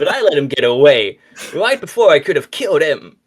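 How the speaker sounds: laughing Sonic voice. Acted. Sonic laughing while telling.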